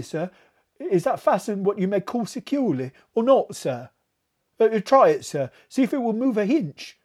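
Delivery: a nervous servant insisting the port won't stay shut; anxious steward. A narrator's reading. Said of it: "faltered Robert".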